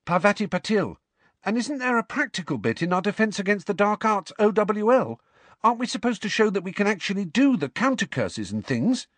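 high-pitched, puzzled pupil; questioning schoolgirl. A narrator's reading. Student asking professor about the practical bit, puzzled. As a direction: query it in a high girlish voice.

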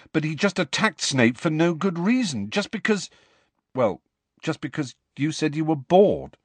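high-pitched protest faltering into apology; slightly apologetic. A narrator's reading. Harry challenges Sirius over attacking Snape, slightly apologetic. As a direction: protest high, hesitate, finish a touch sheepishly. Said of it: "slightly apologetic note in his voice".

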